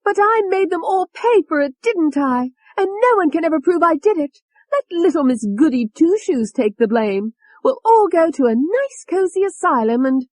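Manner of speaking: very high, loud triumphant sneer; Tony gloating. A narrator's reading. Tony gloating to David about the killings, high sneer. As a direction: gloat breathlessly over the murders. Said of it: "She was breathing hard".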